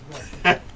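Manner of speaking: short breathy smug laugh; a gloating little laugh down the phone; smug chuckle. Acted. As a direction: chuckle at your old rival. Smug greeting to rival.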